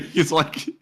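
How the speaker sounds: normal talking voice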